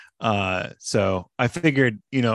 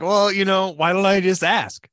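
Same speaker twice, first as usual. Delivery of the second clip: breezy casual; 7 semitones higher than usual. Retold or imitated. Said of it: "So I'm like".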